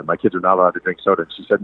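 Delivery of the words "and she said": ordinary talk, nothing marked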